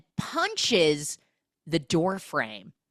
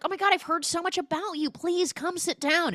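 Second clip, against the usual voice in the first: high-pitched, eager hospitality; mock gushing welcome; 12 semitones higher than usual. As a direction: gush fast, then stretch the invitation. Retold husband gushing over guest.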